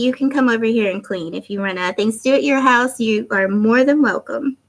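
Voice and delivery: ordinary delivery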